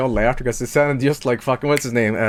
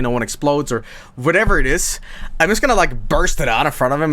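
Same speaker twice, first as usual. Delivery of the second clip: ordinary talk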